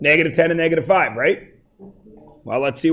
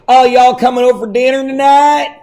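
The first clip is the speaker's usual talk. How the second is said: put-on Southern accent; 5 semitones higher than usual. Teacher mocking Tennessee speech for the class, higher Southern accent. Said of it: "smart people in Tennessee that actually say".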